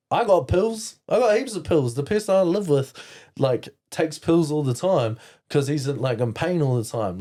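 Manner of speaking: high, loudish eager boast; mock your own clueless eagerness; dumb younger self. Boy offering pills to his crush, mock-clueless eagerness. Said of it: "My dumb ass was like".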